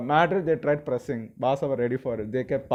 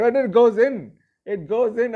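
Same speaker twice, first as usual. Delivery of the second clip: excited goal call; 10 semitones higher, 8 dB louder than usual. Retold or imitated. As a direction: voice jumps high and loud on "goes in". Goal reaction while recapping match.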